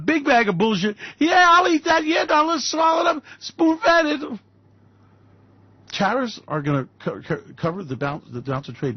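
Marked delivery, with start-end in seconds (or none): 1.2-4.4 high mocking voice of a greedy corporation; mock greedy gulping. Sneering at corporations, in a high mocking gobbling voice. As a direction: ham up the greedy taker swallowing it all.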